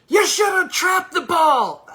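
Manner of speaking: loud, high shouted sideline yell; imitating a yelling parent; mock parent yell. Parent yelling at kid on field.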